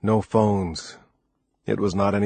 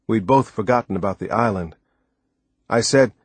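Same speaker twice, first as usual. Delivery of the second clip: ordinary delivery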